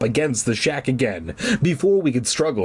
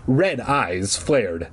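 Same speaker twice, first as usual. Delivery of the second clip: ordinary delivery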